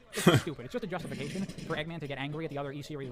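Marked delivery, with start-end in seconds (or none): 0.1-0.7 laughing. Real, not acted. Exasperated rant ends in laugh.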